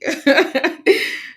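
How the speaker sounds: short high giggle, real; high burst of laughter. Real, not acted. Laughs at herself.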